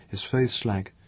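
plain reading voice

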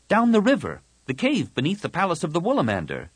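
put-on high, quick girl's voice; eager suggestion. Tahiri offers a hiding place, eager. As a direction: burst in high with the idea.

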